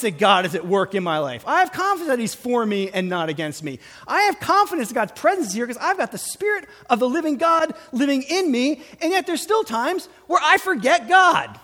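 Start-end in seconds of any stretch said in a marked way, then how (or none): 4.1-11.6 fast, high, loud fervent preaching. Real, not acted. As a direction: preach it with rising fire. Preaching at full force.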